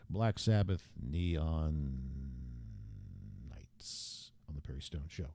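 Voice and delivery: deep, low late-night radio DJ; low hushed progressive rock DJ; FM jock voice. Shown as a demonstration. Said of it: "I was doing my old progressive F M jock voice".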